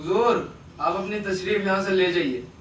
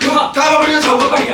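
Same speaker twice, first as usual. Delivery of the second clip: louder, urgent cry of alarm; panicked alarm; 4 semitones higher, 6 dB louder than usual. Acted. Friend warns Sundari of a police raid, in loud panic. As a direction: shout the warning that the police are here.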